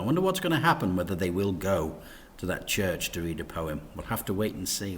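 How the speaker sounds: ordinary delivery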